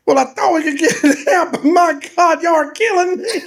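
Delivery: high, wailing imitated protest; mock anguished whine. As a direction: imitate a hurt boy complaining through pain. Acts boy wailing in complaint.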